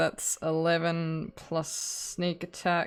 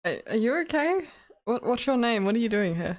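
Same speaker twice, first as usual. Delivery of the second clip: concerned adventurer; 6 semitones higher, 4 dB louder, faster than usual. Acted. Player voices character to stranger.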